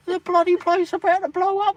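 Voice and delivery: high mock alarm; bewildered. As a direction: squeak out the worry. Imagined panic.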